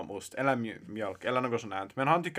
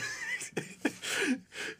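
high squeal; 8 dB quieter than usual. Real, not acted. Giggling greeting to the confirmands.

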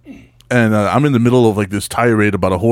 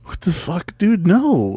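woken-up protest; 8 semitones higher, much slower than usual. Retold or imitated. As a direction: complain like someone just shaken awake. Brother woken at three a.m.

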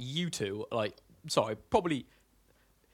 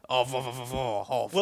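repeated mock-French farewell; hammed-up French accent. Joining friends' French accent bit, mock French accent. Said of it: "starting a French accent".

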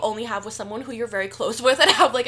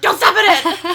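high, loud laughing shout; mock warning yell; 9 semitones higher, 10 dB louder, slower than usual. Man warning the small dog on the floor, laughing mock yell.

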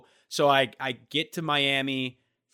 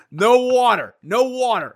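high, loud, insistent mock yell; imitated yelling; 10 semitones higher, 11 dB louder than usual. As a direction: act out an official yelling a ban. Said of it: "He's yelling at me about the water".

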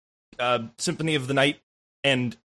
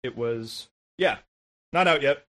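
words squeezed past a held burp; holding back a burp; 6 semitones lower, 3 dB quieter than usual. Real, not acted. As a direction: talk while holding in a burp. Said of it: "Sorry, I'm holding back a burp".

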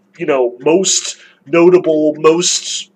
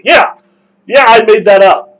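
gleeful admission; 4 semitones higher, 7 dB louder than usual. Pretend. Fyodor voiced cheerfully admitting lie.

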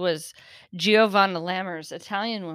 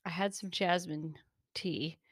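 normal talking voice

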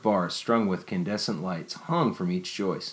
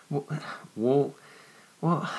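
halting, climbing repeated well; nearly stammering; 7 semitones higher, slower than usual. Acted. Jennifer flustered before Nicholas, stammering higher each time. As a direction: stumble for the words. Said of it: "Jennifer was nearly stammering".

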